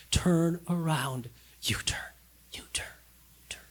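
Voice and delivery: God whispering turn around to the lost. Acted. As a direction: breathe it like a shepherd's whisper. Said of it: "is that whisper".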